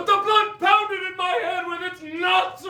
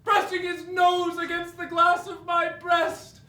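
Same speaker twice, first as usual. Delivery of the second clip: impassioned shout; 4 semitones higher than usual. Acted. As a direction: pour it out in a passionate shout. Impassioned speech about the child.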